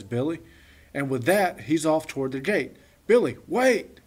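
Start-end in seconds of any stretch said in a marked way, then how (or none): 3.1-4.0 loud, higher urgent call; shout. Acted. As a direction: shout after him as he runs for the gate. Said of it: "shouts Derek".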